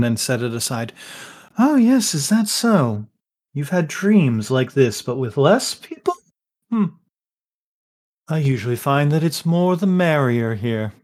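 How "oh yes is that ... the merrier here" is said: lazy amused host toying with guests; lightly voiced amused host. Harpist host teasing the woken guests, lightly voiced amusement.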